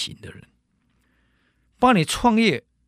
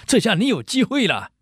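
eager servant; 7 semitones higher, 3 dB louder than usual. Acted. Servant urges master.

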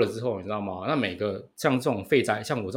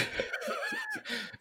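high laugh; 9 dB quieter than usual. Real, not acted. Laughs at friend's remark.

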